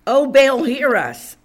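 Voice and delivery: loud mock invocation; crying out to Baal. Preacher imitates Baal's prophets still going, crying out loudly. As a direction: call out loudly to an absent god. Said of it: "they were crying out to Baal".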